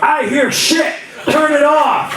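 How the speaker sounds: high, indignant dad yelling to kill the music; hammed-up angry dad; mock dad outburst. Dad yelling at son to turn music off, high mock rant. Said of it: "I'm like".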